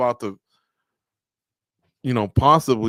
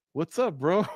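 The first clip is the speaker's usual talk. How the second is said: imitating fighter, laughing; 5 semitones higher, 3 dB quieter than usual. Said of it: "stares at the dog and goes".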